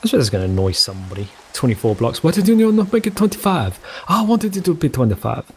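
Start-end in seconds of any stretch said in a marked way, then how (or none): 2.2-5.4 lightly voiced complaining viewer, pitch higher; whiny viewer. Imagined viewer griping about the tower, mild mock whine.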